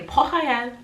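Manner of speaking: Port Royal with a throaty French R; French pronunciation. Shown as a demonstration. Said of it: "is how we would say it".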